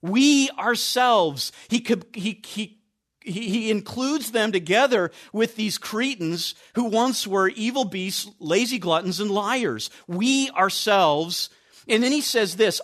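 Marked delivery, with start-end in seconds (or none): 0.0-1.5 slow, stress on "We", "ourselves" drawn out; emphatic. Shown as a demonstration. Preacher stressing the verse to the congregation, emphatic and slow. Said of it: "the way that he expresses this is very emphatic". 10.1-11.5 slow, stress on "We", "ourselves" drawn out; emphatic. Shown as a demonstration. Preacher stressing the verse to the congregation, emphatic and slow. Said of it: "the way that he expresses this is very emphatic".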